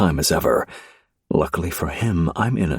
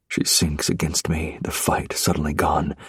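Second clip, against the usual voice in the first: plain reading voice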